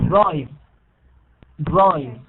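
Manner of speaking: "drive." loud, one syllable with falling tune; correct ending. Shown as a demonstration. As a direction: firmly model "Drive." with no vowel after the v. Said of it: "you have just drive".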